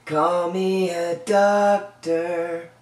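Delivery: ordinary talk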